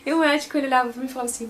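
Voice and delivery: ordinary talk, nothing marked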